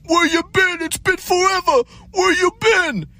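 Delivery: high falsetto mock boomer; hammed-up cheery old guy; boomer impression. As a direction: ape the overfriendly stranger up high. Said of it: "He'll be like".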